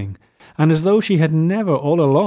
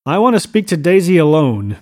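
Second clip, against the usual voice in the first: louder, higher insistent demand; 5 semitones higher, 7 dB louder than usual. A narrator's reading. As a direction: insist firmly, pushing the voice up. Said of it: "he insisted".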